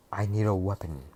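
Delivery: ordinary delivery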